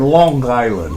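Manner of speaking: Long Island in a thick Kentucky hillbilly drawl; hillbilly accent. Shown as a demonstration. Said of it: "spoken in my best hillbilly accent".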